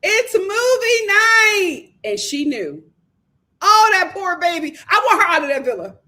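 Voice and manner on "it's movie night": slow, high, mock-crying whine; hammed-up tearful "It's movie night"; dejected sob. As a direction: drag it out like you're about to cry. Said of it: "And she's like".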